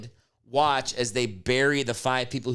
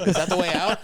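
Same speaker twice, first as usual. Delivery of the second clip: laughing through quote; 6 semitones higher, 4 dB louder, faster than usual. Retold or imitated. Teammate quote, retold laughing.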